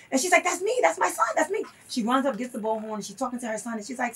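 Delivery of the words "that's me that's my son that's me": high, imitated cry of a mother stepping forward; retold urgent claiming. Mother answering the call for her son, retold urgency. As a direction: act out urgently identifying yourself in a crowd.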